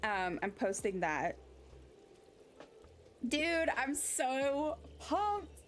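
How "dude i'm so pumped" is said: slow, high, drawn-out "pumped"; hyped. Real, not acted. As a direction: savor the hype, stretching it. Hyped about GTA preorder.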